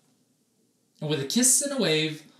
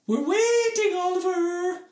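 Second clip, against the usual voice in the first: singsong teacher voice; 5 dB louder than usual. Acted.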